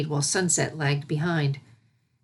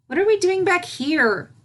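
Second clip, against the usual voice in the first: high, wary filly voice; a filly eyeing water she fears; nervous filly; 13 semitones higher, 4 dB louder than usual. A narrator's reading. Filly back at the pool, nervous. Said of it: "eyeing the treacherous water nervously".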